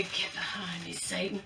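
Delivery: hushed low Get behind me, Satan; quiet retelling. Retold or imitated. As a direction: murmur it to yourself like a remembered saying. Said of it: "She said it for me".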